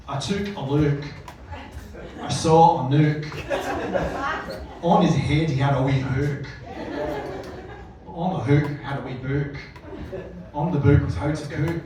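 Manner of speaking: Dr Seuss rhymes read in a Glaswegian accent. Shown as a demonstration. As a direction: read it like a Glasgow dad at bedtime. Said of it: "anything in a Glaswegian accent".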